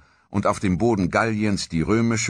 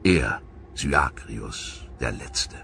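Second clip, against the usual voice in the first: feierlich; 6 semitones lower, slower than usual. Acted. Last Roman awaits death.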